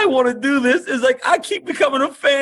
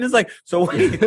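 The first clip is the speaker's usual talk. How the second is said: ordinary talk, nothing marked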